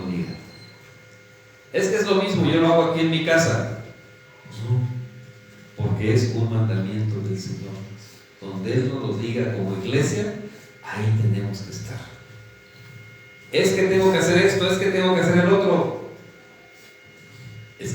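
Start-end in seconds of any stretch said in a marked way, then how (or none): 1.7-3.8 higher, quicker voice for a lazy churchgoer; mock excuse. Preacher mimicking a churchgoer who would rather pray at home, higher and mocking. 13.5-16.1 higher, fast, running-on excuse voice; mock excuse. Preacher imitating a member listing excuses to skip church, higher and quick.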